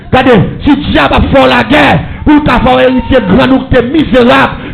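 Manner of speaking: prêche crié, plein de ferveur; proclame-le à toute la salle; sermon prêché à pleine voix. Acted. (prédication criée avec ferveur)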